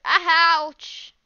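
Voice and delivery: loud pained ouch; sharp ouch. Real, not acted. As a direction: yelp it sharply in pain. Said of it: "my finger hurts".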